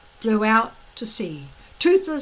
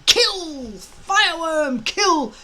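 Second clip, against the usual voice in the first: yelling; 6 semitones higher, 4 dB louder, slower than usual. A narrator's reading. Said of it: "broke off from yelling".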